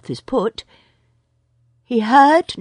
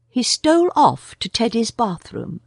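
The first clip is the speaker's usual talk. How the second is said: ordinary delivery, nothing marked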